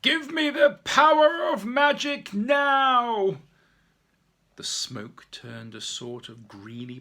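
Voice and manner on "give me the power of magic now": high, loud and slow magic command; very loud shout. Acted. Peter calling on magic to stop the fire, very loud. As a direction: summon the magic with full force. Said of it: "shouted out very loud".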